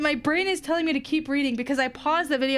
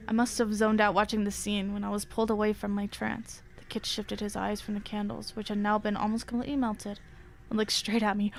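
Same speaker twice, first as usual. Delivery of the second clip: low, hushed horror storytelling; story narration; 7 semitones lower, 6 dB quieter than usual. A narrator's reading. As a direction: read it low and quiet, drawing us in. Reading horror story aloud.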